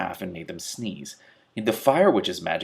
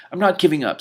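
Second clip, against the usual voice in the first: determined; 9 semitones higher, 7 dB louder than usual. Acted. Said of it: "Simmarine repeated".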